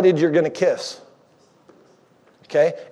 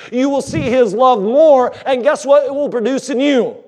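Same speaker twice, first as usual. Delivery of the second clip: high, fervent build toward the point; preaching at full force; 8 semitones higher than usual. Acted. Pastor closing his point on love, in a fervent preaching voice. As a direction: lift the church with it, rising.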